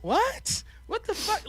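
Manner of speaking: high, incredulous mock what; mock stunned disbelief. Stunned novice reacts to an insult, mock disbelief. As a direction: sound completely thrown.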